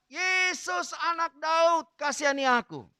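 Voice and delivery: high, long-drawn cry for mercy; acted shout. Blind beggar calling Jesus over the crowd, high drawn-out shout. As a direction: stretch the name out in a high plea. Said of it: "dia berteriak".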